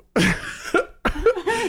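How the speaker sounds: rising, high-pitched laugh; high giggle. Real, not acted. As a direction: giggle up into a squeal. Co-host laughs at monkey question.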